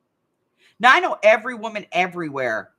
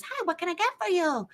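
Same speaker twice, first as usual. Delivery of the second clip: higher-pitched greeting from the order taker; imitating the drive-through girl, pitched up; server girl greeting; 11 semitones higher, 4 dB quieter than usual. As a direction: lift the voice like a cheery young server. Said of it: "She goes".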